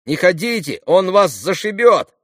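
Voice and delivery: put-on high, loud boy's warning; warning shout. A narrator's reading. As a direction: shout to stop him going. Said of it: "закричал предупредительно Смуров". (предостерегающий крик)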